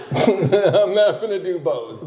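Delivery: laughing through the punchline. Real, not acted. Joking about rent with a friend, laughing. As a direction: chuckle the words out slowly.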